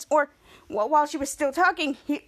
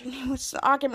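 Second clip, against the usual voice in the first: plain reading voice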